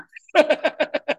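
a squealing high laugh; high burst of laughter. Real, not acted. Preacher laughing at selfie-crazy sanguine people, high squealing laugh. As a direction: laugh high and loud at the thought.

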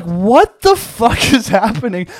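high, slow, laughing what-the-hell; half-laughing at the absurd scene; laughing disbelief. Retold or imitated. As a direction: clerk watching a man pull a tongue with sticks, laughing. Man pulling tongue with sticks.

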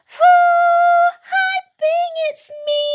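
put-on very high, loud little-girl shout; high-pitched excited calling. A narrator's reading. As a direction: shout an excited greeting in a squeaky child voice. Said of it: "shouts Sula".

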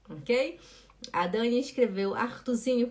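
usual speaking voice

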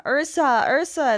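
light, retold shout; imitated calling out. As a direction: call her name as if from afar. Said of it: "someone's yelling her name".

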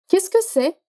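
"Qu'est-ce que c'est" pitched high as a question; rising question intonation. Shown as a demonstration. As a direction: lift the voice on "c'est", asking. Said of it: "A entonação vai subir".

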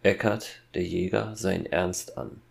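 plain reading voice

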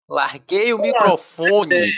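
very slow, drawn-out mic drop; drawn-out announcement. Pretend. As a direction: stretch every syllable like a mic drop. Joke mic drop line.

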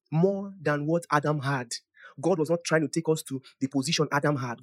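normal talking voice

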